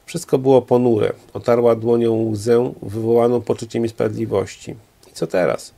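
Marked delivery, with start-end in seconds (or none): 5.1-5.7 low, falling question into emptiness; lower, resigned question to an empty room; lost question. A narrator's reading. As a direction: drop the pitch, asking nobody. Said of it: "rzuciła pytanie w pustką pokoju".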